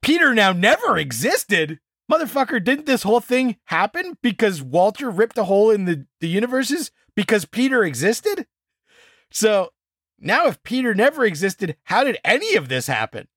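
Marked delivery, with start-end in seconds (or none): 0.0-1.8 higher and disbelieving, rising on never; outraged disbelief at the twist; incredulous. Real, not acted. Incredulous repeat of observers' line. 2.1-12.2 exasperated rant. Real, not acted. Ranting about the plot hole.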